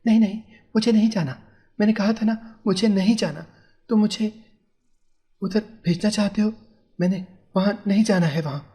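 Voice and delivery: high, pouting voice insisting; sulky refusal. A narrator's reading. Wife refuses to visit her parents, pouting high. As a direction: pout and refuse like a stubborn child. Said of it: "वो मुंह बनाती हुई बोली".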